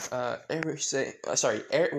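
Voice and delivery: ordinary delivery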